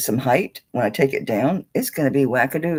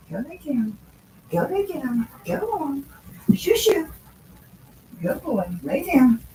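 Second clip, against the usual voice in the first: higher, gentle pet-talk commands; coaxing the dog; 8 semitones higher than usual. Real, not acted. Owner sends her dog to lie down, higher coaxing pet voice. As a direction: shoo him off kindly to bed.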